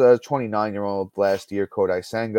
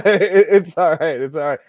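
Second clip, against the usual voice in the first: louder, higher, through real laughter; amused and forgiving, voice lifted by laughing; laughing reassurance; 5 semitones higher, 8 dB louder than usual. Real, not acted. Laughing off co-host's flubbed words.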